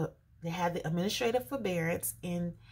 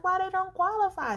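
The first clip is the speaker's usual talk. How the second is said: higher and louder, indignant demand; agitated; 12 semitones higher, 6 dB louder than usual. Retold or imitated. Asking the loan agent why, agitated and high. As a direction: press the agent, worked up. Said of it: "Sorry for the shaking 'cause I'm excited".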